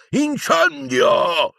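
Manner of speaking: high, long-drawn shout; yelled spell. A narrator's reading. Death Eater casting fire behind Harry, yelling. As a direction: stretch the incantation into a high yell. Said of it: "alle sue spalle urlava".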